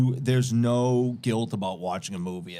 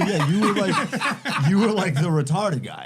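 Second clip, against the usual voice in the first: laughing quote; 6 semitones higher than usual. Retold or imitated. Old classmates recognising him, laughing.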